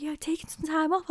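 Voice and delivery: hushed whispered quote of her partner; imitating him in a whisper; retold whisper. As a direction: breathe it out like a quiet aside. Retelling partner's reply.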